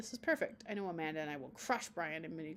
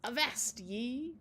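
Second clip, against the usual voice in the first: pirate talk; 4 semitones higher, much slower than usual. Acted. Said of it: "talk like a pirate with me".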